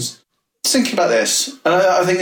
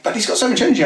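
mock protest; 10 semitones higher, much faster than usual. Owner objecting in retold exchange.

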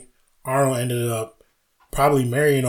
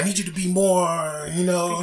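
higher, gentle coaxing; director coaching; 6 semitones higher than usual. Retold or imitated. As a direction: coax the actor, a bit higher. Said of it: "He's like".

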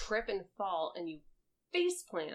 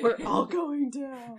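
slow, ominous pronouncement; hammed-up doom announcement; mock doom; 7 semitones higher, 8 dB louder, slower than usual. Host warning the players, slow and ominous.

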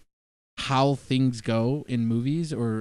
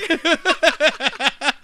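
high giggle; 13 semitones higher, 4 dB louder than usual. Retold or imitated. Laughing mid genie impression.

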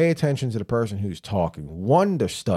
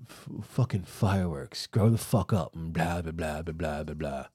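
mock whiny cousin; 4 semitones lower, 7 dB quieter than usual. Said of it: "the other ones that are like".

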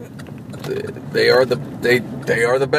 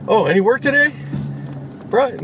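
lightly mimicked coworker, higher and chirpy; mocking coworker; 9 semitones higher than usual. Mimicking the night-shift guy asking coworkers about work, higher. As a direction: poke fun at the night guy's question. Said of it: "Ask me and the other guy".

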